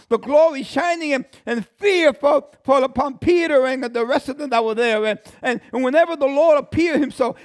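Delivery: high, loud preaching push; fiery preaching. Acted. Preacher building the vision of the temple, full-force preaching. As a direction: drive it home to the congregation.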